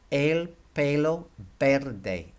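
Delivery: el pelo verde said slowly, each e like A Y; slow teaching model of el pelo verde; careful Spanish. Shown as a demonstration. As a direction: stretch out el pelo verde, clear AY vowels. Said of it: "if you want to say this correctly".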